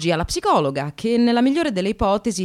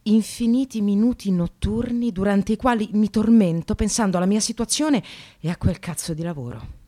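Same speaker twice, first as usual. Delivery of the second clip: ordinary delivery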